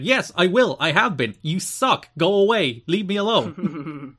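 hammed-up fed-up retort; indignant outburst. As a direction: snap back loudly, dragging out the last words. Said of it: "Mike Tenay's like".

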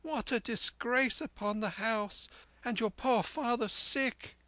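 very high-pitched lamenting voice; distressed mother. A narrator's reading. Mother laments the disgrace with father sick, high and dismayed. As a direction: fret and wail in a put-on woman's voice. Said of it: "cried my mother".

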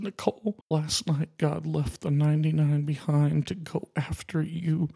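real tears breaking through at the end. Real, not acted. Telling Nicole God came after her, tearful whisper. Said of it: "I did promise I'd get emotional".

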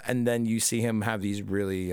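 ordinary talk, nothing marked

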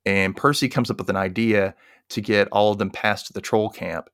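ordinary talk

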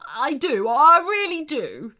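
loud, rising, emphatic vow; earnest insistence. Acted. Shark convincing the mermaid, emphatic promise. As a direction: insist with all your heart.

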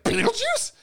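very high incredulous Beetlejuice; mock disbelief. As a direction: brother mocking the nightmare in disbelief. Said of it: "My brother's like".

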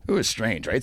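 ordinary delivery

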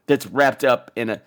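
normal talking voice

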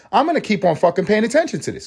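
high, mock toddler's inner voice; voicing the baby's thoughts. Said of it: "make her go".

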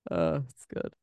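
ordinary talk, nothing marked

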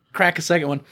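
ordinary talk, nothing marked

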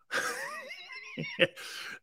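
shrieking laugh. Real, not acted. Burst of laughter.

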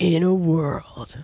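slow, dramatic trailer narrator; movie trailer voice. Acted. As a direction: announce it like a blockbuster trailer. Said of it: "that's, like, your trailer voice?".

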